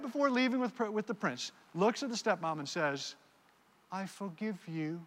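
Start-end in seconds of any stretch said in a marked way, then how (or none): 3.9-5.0 put-on quoting Cinderella in a soft English accent; a light English lilt on the forgiveness. Pastor quoting Cinderella to the stepmom, light English accent. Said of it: "in a beautiful English accent".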